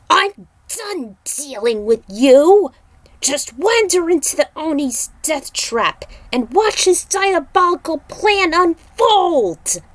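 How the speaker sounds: high, loud and slow, furious; a loud, slow, enraged tirade at the demons; raging yell. Acted. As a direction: scream your fury after them. Kung Lao raging at fleeing demons.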